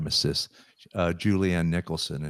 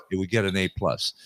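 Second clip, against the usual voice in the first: ordinary talk, nothing marked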